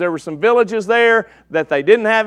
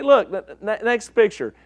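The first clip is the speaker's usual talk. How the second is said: usual speaking voice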